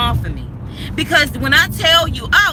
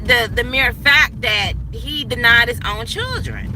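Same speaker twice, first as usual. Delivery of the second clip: ordinary delivery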